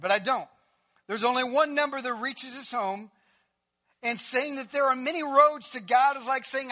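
loud, high sermon delivery; full-force preaching. Real, not acted. Preacher to the congregation on the narrow truth, loud. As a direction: drive the point home to the pews.

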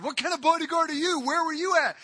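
mock his failure to guard; taunting. David mocks the bodyguard who slept, taunting. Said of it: "he taunts the bodyguard".

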